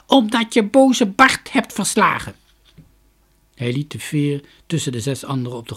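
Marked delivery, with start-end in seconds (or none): 0.1-2.3 parrot voice. A narrator's reading. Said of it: "sprak de papegaai".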